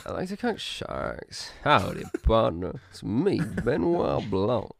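slow, soft mock American drawl; American accent. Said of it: "the only American accent I can do".

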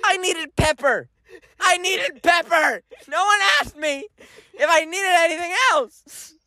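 high, hammed-up wail of protest; indignant complaint. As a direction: whine it out in mock outrage. Acted indignant complaint about waitress.

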